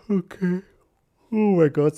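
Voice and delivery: talking through a yawn; words stretched by a yawn. Real, not acted. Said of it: "I'm yawning into the microphone".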